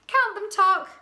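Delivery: high, loud excited command; excited cry. A narrator's reading. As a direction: urge the timekeeper on excitedly. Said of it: "cried Milo excitedly".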